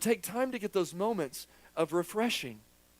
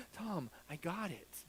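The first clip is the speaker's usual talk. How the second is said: calm reply as God; 14 dB quieter, slower than usual. Retold or imitated. Voicing God's calm reply.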